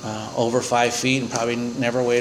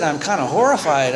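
ordinary talk, nothing marked

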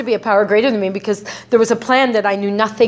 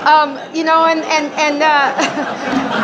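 ordinary delivery

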